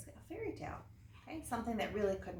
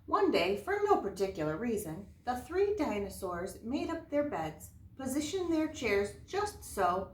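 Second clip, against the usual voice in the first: bright, louder read-aloud storyteller voice; story narration; 3 semitones higher, 6 dB louder than usual. Acted. Teacher reading a fairy tale to her class, lively storyteller voice.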